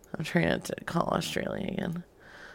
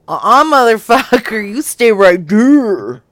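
loud, sassy bossing; 3 semitones higher, 14 dB louder than usual. Acted. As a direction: bark it like a dog keeping the other in line. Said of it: "He's very sassy".

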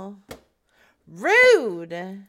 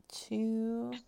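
hiccups; 10 dB quieter than usual. Real, not acted. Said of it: "Don't laugh at my hiccups".

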